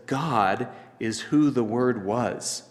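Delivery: God drawn out first, slow line; emphatic GOD up front. Shown as a demonstration. Preacher shows the Greek word order, emphatic slow God first. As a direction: slowly set God apart at the start. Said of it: "bring the important word to the beginning".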